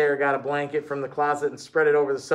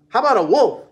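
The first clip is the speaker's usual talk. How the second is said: eager yell, louder; 5 semitones higher, 6 dB louder than usual. Acted. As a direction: call out the question excitedly. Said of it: "David yelled".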